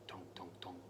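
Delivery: very soft, low tonking; soft creepy tonk. A narrator's reading. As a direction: breathe a faint tonk tonk. Creepy carrot sound.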